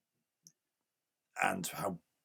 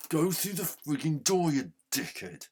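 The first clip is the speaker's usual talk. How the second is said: higher, louder yell at the screen; angry shout; 7 semitones higher, 6 dB louder than usual. Real, not acted. Gamer yelling at his character to use the door, angry shout. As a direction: bark the order at a stubborn sprite.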